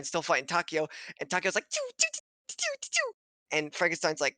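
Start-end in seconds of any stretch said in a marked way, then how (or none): 1.7-3.1 high-pitched choo choo noises, much higher; imitated choo sounds. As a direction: squeak out choo choo like a toy train, very high.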